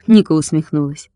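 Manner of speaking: usual speaking voice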